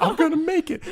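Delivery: high, tearful laugh-cry; real tears breaking through a laugh; choked up. Real, not acted. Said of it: "That's how you know I'm getting emotional".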